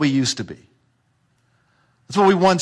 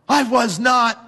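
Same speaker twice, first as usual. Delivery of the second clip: higher, indignant protest; mock denial; 6 semitones higher, slower than usual. Preacher voicing a congregant's indignant denial, higher. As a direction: play the offended listener saying no. Congregant denying being slave.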